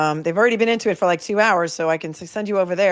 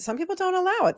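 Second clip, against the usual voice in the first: squeaky whine; 13 semitones higher, 3 dB quieter than usual. Pretend. Mock whine.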